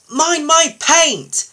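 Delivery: high, loud, indignant snap; cross lorry yelping in protest; snapping. A narrator's reading. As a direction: snap a drawn-out warning, voice shooting up. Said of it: "snapped a lorry".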